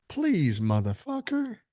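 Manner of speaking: exasperated scoff. Real, not acted. Rebuffing the viewer's assumption.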